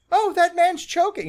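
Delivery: startled woman pointing something out; alarmed date voice. Retold or imitated. Rita voiced noticing choking man.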